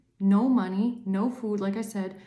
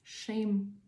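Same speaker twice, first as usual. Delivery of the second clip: slow fading; 4 dB quieter, slower than usual. Real, not acted. Slow disgusted fading repetition.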